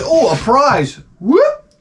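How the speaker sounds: high squeaky whoop of delight; mock kid excitement. Kid opening a Happy Meal, high mock whoop. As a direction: thrill over a cheap toy. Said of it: "was basically like this".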